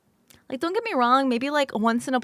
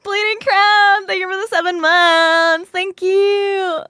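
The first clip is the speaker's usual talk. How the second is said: excited thanks; 6 semitones higher, 5 dB louder, slower than usual. Real, not acted. Thanking subscriber loudly.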